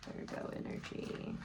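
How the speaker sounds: breathy hushed whisper, almost to herself. Real, not acted. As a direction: murmur it under your breath while reading. Whispering the card reading aloud.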